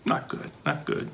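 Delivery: soft tutting whisper; hushed mock disapproval. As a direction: whisper a gentle scolding to the kids. Tutting at rude dinosaur.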